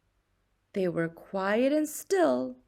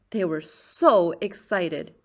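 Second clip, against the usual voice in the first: ordinary delivery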